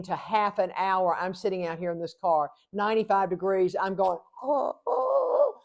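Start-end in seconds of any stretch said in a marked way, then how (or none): 4.4-5.6 high, pitched-up cries of "Oh, oh"; distressed calls. Retold or imitated. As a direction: call out high and helpless from the hot car. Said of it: "like that".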